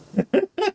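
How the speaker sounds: short, high-pitched laugh; a quick high burst of real laughter. Real, not acted. Player amused the woman may not exist, high laugh.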